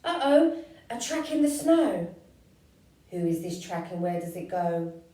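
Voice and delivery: startled shout at claw marks; surprised child. Acted. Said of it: "child finds track".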